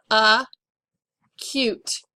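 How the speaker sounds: "Acute" broken as UH, long pause, CUTE; "Acute" pulled apart for spelling; syllable by syllable. Shown as a demonstration. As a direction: dictate "Acute" in two separated syllables. Dictating a word by syllables.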